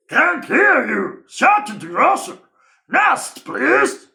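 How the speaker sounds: loud, high brush-off; shouting grocer. Acted. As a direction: shout him away impatiently. Grocer dismisses him loudly.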